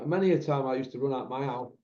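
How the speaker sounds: normal talking voice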